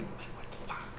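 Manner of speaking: breathless whispered shock; retold whisper. She confronts Mike after it happens again, whispered shock. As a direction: hiss it under your breath.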